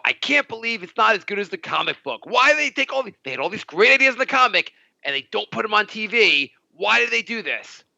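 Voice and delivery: imitating Jay's fed-up rant over the comic; mock whining rant. Host mocking Jay's complaints about the show, whiny exasperated rant. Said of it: "all you do is talk about how".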